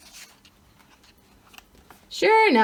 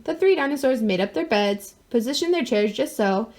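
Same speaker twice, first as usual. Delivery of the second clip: plain reading voice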